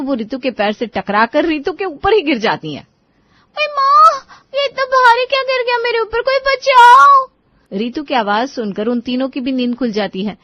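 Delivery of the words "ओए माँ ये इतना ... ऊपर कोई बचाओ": high, loud, startled squeal; panicked cry for help. Acted. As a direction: squeal for help as a weight lands on you. Ritu crushed under falling mother-in-law.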